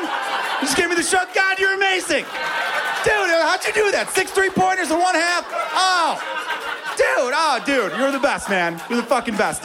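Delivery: a thrilled husband cheering on Jordan; ecstatic fanboy shouting. Retold or imitated. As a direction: scream praise at your idol like a kid at a game. Gushing at Jordan mid-affair.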